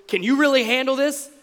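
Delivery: mildly acted tough-guy dare; Chuck Norris. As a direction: throw it down as a light action-hero challenge. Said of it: "that's the Chuck Norris version of this".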